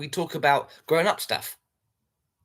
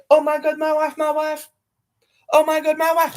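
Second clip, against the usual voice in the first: mock high wail; 11 semitones higher, 5 dB louder than usual.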